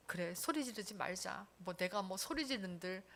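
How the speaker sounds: soft, low giving-up voice; imagine quietly talking yourself out of it; subdued, pretend inner voice; resigned. Acting out someone giving up.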